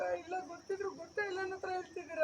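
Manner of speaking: soft acted crying. Shown as a demonstration. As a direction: demonstrate a quiet tearful plea. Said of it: "ಇನ್ನು ಅಳು".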